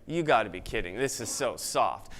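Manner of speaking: low, slow dismissive sneer; mocking scoff. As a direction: scoff at it like a cynic.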